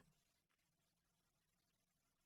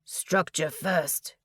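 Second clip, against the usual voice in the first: ground out; 2 semitones lower, much slower than usual. A narrator's reading. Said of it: "he ground out as he fought".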